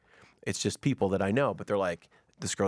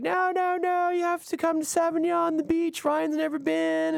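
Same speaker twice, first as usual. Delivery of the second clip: very high put-on female voice; insistent woman friend. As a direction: plead in a mock high-pitched voice. Imitates wife's friend insisting on going.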